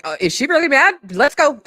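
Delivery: high, loud voice for the startled dog; squeaky voice for a dog that bolts; voicing the dog. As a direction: play the dog bolting in alarm. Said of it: "he was like".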